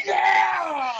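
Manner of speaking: high, loud cheer; excited shout. Real, not acted. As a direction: whoop with delight. Cheering a dirty joke.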